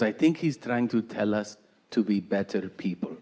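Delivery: put-on low, soft Peruvian-accented mom; mother's Peruvian accent. Mom explaining God's message to son, soft Peruvian accent. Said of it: "she's from South America".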